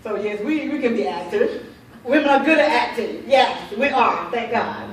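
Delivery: laughing loudly through the words. Real, not acted. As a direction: laugh while you joke about acting. Joking that women are good actors.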